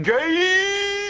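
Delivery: high, long drawn-out cry; background shout. Retold or imitated. Chang yelling from the background, high drawn-out shout. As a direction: stretch it out in a high-pitched yell. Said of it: "with Chang in the background shouting".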